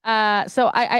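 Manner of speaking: ordinary talk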